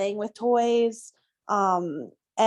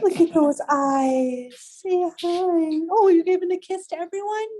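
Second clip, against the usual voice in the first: higher, louder sing-song pet talk; 8 semitones higher, 4 dB louder than usual. Real, not acted. Cooing at the puppy on camera.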